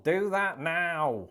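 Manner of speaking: blunt American-style demand, stretched and punched at the end; direct command. Acted. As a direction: order them bluntly, drawing out the final words. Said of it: "Americans tend to be more direct".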